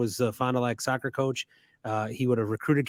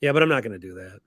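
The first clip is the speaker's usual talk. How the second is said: usual speaking voice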